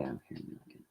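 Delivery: breathy whisper while writing it down; whispered to herself. Real, not acted. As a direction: murmur the name under your breath. Muttering the name to herself.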